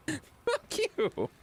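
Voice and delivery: high laughing "fuck you" through giggles; laughing retort. Real, not acted. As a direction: laugh it off while swearing back. Teased as brat, laughing back.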